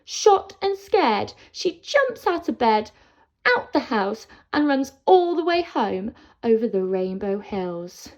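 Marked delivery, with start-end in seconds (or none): 0.0-5.6 alarmed narration. A narrator's reading. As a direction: tell it with rising alarm. Goldilocks wakes in fright.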